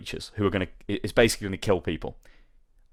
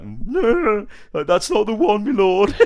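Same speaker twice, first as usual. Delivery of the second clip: high, whining comic peasant; peasant villager voice; 11 semitones higher, 4 dB louder than usual. Acted. Villager tells the Witcher it's the wrong one, whining peasant voice. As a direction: whine the refusal in a put-on high voice. Villager rejects the shield found.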